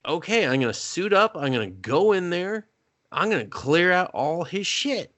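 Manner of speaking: high-pitched mock determination; retold resolve. Retelling Blake's resolve to act.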